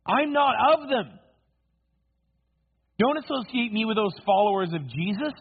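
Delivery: high, loud, acted protest; scornful denial. Peter disowns the followers of Jesus, scornfully.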